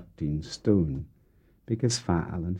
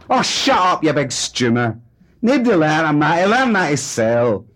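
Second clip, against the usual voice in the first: high, loud dismissive snap; wife telling husband to shut up; angry retort; 9 semitones higher, 9 dB louder than usual. Acted. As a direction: shut him up with contempt. Wife snapping back.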